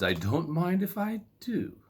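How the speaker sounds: a hint of a delighted young girl; Goldilocks' voice. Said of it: "said Goldilocks".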